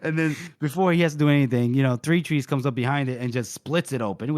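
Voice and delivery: usual speaking voice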